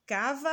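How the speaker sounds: Cavalo with a high, long, held VA; calling out, drawn-out. Shown as a demonstration. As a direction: call out Cavalo, stretching the middle syllable va. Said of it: "chamando, que é pra gente identificar".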